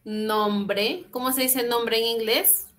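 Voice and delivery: normal talking voice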